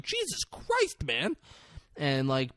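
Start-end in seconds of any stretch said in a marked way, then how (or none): 0.0-1.4 shocked disbelief. Retold or imitated. Character reacts in disbelief.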